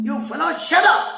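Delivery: high, fast furious yell; roaring shout. Acted. Wife roaring at her husband to shut up. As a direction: roar at him to stop. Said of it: "And it was a roaring sound".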